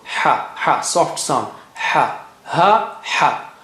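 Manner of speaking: ha said breathy from the middle of the throat against a plain ha; two ha sounds. Shown as a demonstration. Teacher contrasting the two ha letters for learners. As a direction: alternate the two ha. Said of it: "middle of the throat".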